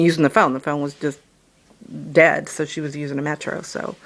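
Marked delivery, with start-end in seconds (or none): none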